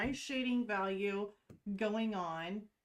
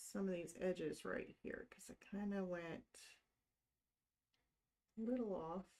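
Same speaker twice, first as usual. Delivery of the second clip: quiet, breath held tight; holding breath; 5 dB quieter than usual. Real, not acted. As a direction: talk while holding your breath. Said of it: "Only because I'm holding my breath".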